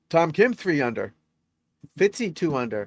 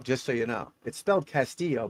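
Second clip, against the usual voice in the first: usual speaking voice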